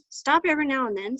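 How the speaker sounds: plain reading voice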